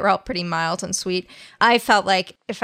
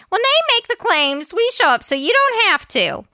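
high-pitched; 12 semitones higher, 4 dB louder than usual. Acted. High intro tagline.

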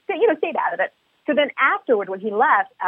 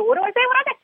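high panicked self-talk; mock inner panic; 13 semitones higher, much faster than usual. Mom caught mocking a kid, climbing mock panic. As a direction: climb higher as you scramble for words.

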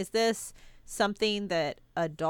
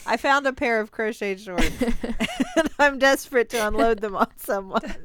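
louder pitch broken up by laughter; laughing mock pitch; 3 semitones higher, 6 dB louder than usual. Pitching crochet shorts to the sharks, laughing through it. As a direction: try to sell it while cracking up.

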